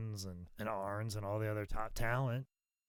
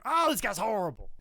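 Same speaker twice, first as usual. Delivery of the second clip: higher, louder mock disgust; imitating George; 10 semitones higher, 6 dB louder than usual. George South judging a rookie, mock disgust. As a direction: scoff like a veteran wrestler. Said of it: "hear George going".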